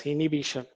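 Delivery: "inhibition" as in-ih-BISH-un, the h dropped; "inhibition" with no b after the first n; wrong pronunciation. Shown as a demonstration. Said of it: "Don't pronounce it as".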